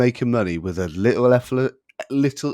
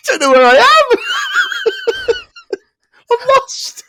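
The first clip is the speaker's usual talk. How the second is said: high wailing through real laughter; loud, high, giggle-choked confession; helpless laughter; 7 dB louder than usual. Real, not acted. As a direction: lose it completely, squeaking the words out.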